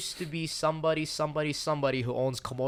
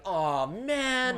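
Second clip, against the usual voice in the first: alarmed; 11 semitones higher, 3 dB louder than usual. Retold or imitated. Lifted off ground, alarmed.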